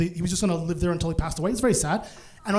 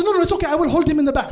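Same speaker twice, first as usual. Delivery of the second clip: higher-pitched mom impression; lightly voiced mom, pitched up and insistent; imitated mom; 7 semitones higher, 4 dB louder, much faster than usual. Mom offering to hold the dog, higher insistent voice. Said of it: "But Mom's like".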